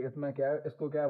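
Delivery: ordinary delivery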